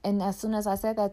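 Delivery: usual speaking voice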